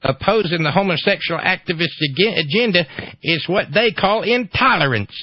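high-pitched mock Ohio accent; ignorant Ohio voice. Host quotes the activist on intolerance, ignorant Ohio voice. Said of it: "my ignorant Ohio voice".

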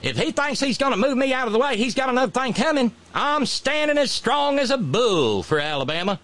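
high, fast, hammed-up Southern defiance; Governor Meemaw. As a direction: bluster like a folksy governor. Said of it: "Governor Meemaw ... said".

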